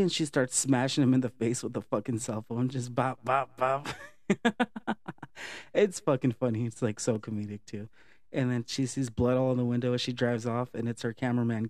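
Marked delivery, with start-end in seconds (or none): 4.3-5.7 a burst of high-pitched real laughter. Real, not acted. Laughing at the phone beating.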